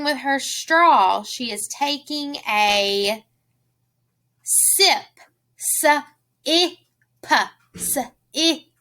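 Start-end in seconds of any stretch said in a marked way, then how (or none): 5.8-8.7 "S-I-P" as separate sounds sss, ih, puh; sounded out. Shown as a demonstration. Teacher sounding out for children, "S-I-P" as suh, ih, puh. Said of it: "Do you hear that I sound?".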